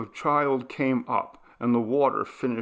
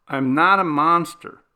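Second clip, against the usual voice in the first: defensive retort; 5 semitones higher, 8 dB louder than usual. A narrator's reading. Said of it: "he answered silently".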